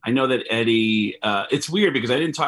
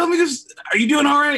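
much higher, louder flustered check-in; awkward concern; 5 dB louder, faster than usual. Retold or imitated. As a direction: nervously ask if he's okay. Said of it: "I just felt weird".

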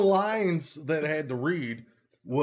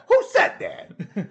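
high, loud mock challenge; slow, high put-on voice, amused; hammed-up cartoon demand; startled cartoon cat; 7 dB louder, slower than usual. Echoing the startled cat's line.

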